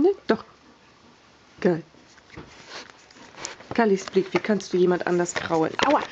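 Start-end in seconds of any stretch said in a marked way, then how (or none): 5.9-6.0 getting a leg cramp. Real, not acted. Sudden pain cries.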